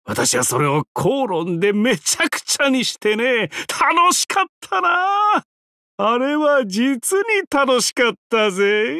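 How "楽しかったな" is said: gleeful. Acted. Gleeful memory of ruined feast.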